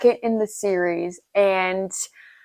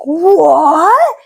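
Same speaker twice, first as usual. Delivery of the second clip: mock shocked scream; 6 semitones higher, 6 dB louder than usual. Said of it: "will have you on the floor screaming".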